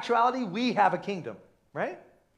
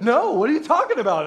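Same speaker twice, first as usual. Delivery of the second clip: loud, higher mock indignation; imitated daughter's protest; 5 semitones higher, 10 dB louder, faster than usual. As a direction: protest like an alarmed teen. Daughter's protest to dad, acted.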